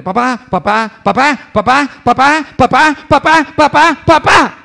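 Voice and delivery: imitated kid nagging for attention; noisy child. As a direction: build each repeat into an insistent high shout. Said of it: "entra el hijo metiendo bulla".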